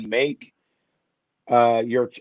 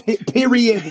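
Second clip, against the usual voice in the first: high, loud mock echo of the host; teasing mimicry; 10 semitones higher, 6 dB louder, slower than usual. Guest teasing the host's catchphrase, high mocking imitation. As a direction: mimic them with a laugh, pitched way up. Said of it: "Is that how I sound?".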